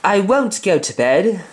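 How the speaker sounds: put-on high, slow, whiny little boy; Michael's voice. Reader voicing little Michael refusing bed, high and whiny. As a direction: whine it like a stubborn small child. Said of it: "So Michael now speaks".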